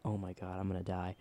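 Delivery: low, soft scared aside; mock frightened murmur. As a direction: breathe it like you're trapped. Terrified thought while attacked.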